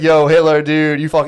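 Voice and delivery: loud, hyped-up shout-out; mock excited hailing. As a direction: call out like an overexcited fan. Acting out fan cheering Hitler on.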